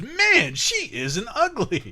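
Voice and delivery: high, slow mock voice reading chat; hammed-up leering fan. Reading a viewer's chat aloud, mock leering fan voice.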